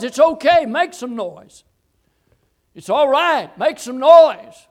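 loud, high preacher's shout; shouted urging. Acted. Preacher urging the crier to make noise, shouting. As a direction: egg them on at full voice.

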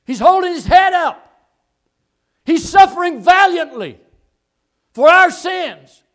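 loud, high, ringing sermon shout; full-force preaching. Real, not acted. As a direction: proclaim it valiantly to the congregation.

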